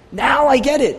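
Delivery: the penny drops, say it with joy; sudden realization. Acted. Jonah grasping salvation, an excited burst of realization.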